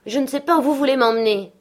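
put-on upset child raising his voice; child's outcry. A narrator's reading. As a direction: cry it out, refusing to go. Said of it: "s'écria-t-il enfin".

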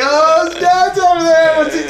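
high, loud mock jeer through laughter; laughing teasing. Retelling friends on the headset spotting dad, laughing tease. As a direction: imitate the gang cracking up, loud and high. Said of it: "they all start laughing".

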